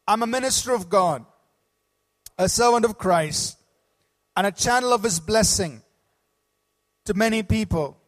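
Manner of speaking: loud, bold group creed; bold declaration. Acted. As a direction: proclaim each line boldly. Congregation declaration said aloud.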